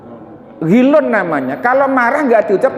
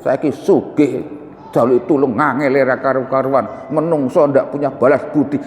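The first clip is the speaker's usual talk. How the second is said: bitter inner grumbling; 6 semitones lower than usual. Retold or imitated. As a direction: mutter it to yourself, sulking over ingratitude. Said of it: "Jenengan ngunek-ngunek".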